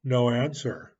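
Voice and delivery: ordinary delivery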